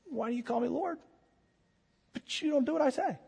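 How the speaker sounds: soft questioning. Acted. Jesus gently questioning his followers.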